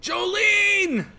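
very high drawn-out call; desperate cry. Acted. Friend calls as heroine drops, desperate high cry. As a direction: cry the name after a falling friend.

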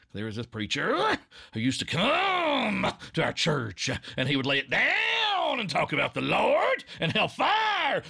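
high, loud, slow preacher voice laying it down; old hellfire preacher. Retold or imitated. Recalling his childhood church preacher, loud and slow. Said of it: "based on an old preacher".

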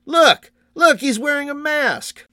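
very high, loud jeer; piercingly high child's taunt; sneering young boy. A narrator's reading. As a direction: cry out mockingly like a boy pointing. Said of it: "his own face twisted in a sneer".

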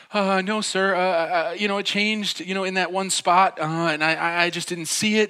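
high, stammering excuse; struck with fear. Retold or imitated. Driver explaining himself to police officer, nervously pleading. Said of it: "so struck with fear".

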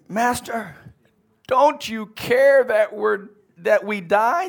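high, loud frightened cry of the disciples; panicked disciples. Acted. Disciples crying out to Jesus in the storm, frightened. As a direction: plead in a panic over the storm.

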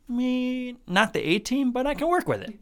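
high, unimpressed shrug of acceptance; high-pitched meh, then willing to make do; God. Acted. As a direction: size them up doubtfully in a high voice. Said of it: "and how God's like".

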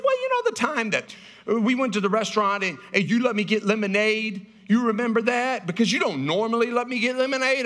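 put-on a kid reminding daddy, pleading; little daughter voice. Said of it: "And she said".

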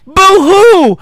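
high, loud sarcastic fake sob; mock boo-hoo. Mocking the survivors' grief.

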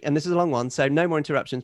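ordinary talk

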